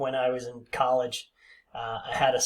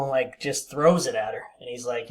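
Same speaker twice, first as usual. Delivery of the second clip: ordinary talk, nothing marked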